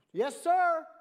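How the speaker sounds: high, loud hammed-up reply; [name] answering up. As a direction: call back high and loud.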